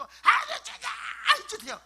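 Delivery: very high, loud wheedling whine; hammed-up aegyo. Praying to Father God for favours, hammed-up aegyo. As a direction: squeal it like a child begging for treats. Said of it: "하나님께 애교 좀 떨어야지".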